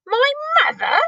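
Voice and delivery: high astonished cry of a giant; shocked giant crying back; cried out. Acted. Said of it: "cried the B F G".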